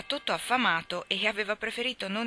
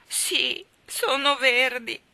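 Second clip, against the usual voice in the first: moaning; 7 semitones higher, 7 dB louder, much slower than usual. Acted. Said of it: "confermò la ragazzina con un gemito".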